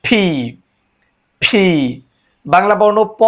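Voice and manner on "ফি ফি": "ফি" with aspirated p and long i; correct letter P. Shown as a demonstration. Teacher modelling the next letter, careful pronunciation. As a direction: puff the p in "ফি". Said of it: "এরপর হচ্ছে".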